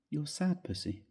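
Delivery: lightly teasing, half joking; a soft half-joking tease to his wife; half jesting. Acted. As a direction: tease her gently, half in jest. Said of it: "I said half jestingly".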